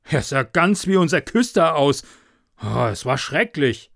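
loud, shaken exclamation; frightened farmer. Acted. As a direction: blurt it out still shocked. Farmer shaken after seeing devil.

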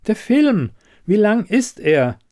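high-pitched, pressing question; insistent demand. Acted. Elida pressing the quizmaster about the film, insistent.

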